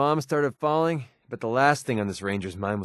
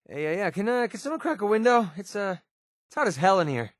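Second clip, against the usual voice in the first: strained complaint; 8 semitones higher than usual. Acted. Complaining of heat, asking for air.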